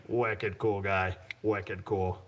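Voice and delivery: slow, relished mock New England accent; Smitty. Host praising Smitty to viewers, mock New England accent. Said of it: "but he doesn't talk like that".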